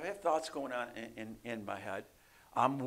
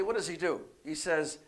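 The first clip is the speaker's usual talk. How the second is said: usual speaking voice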